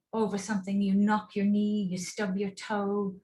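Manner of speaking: ordinary talk, nothing marked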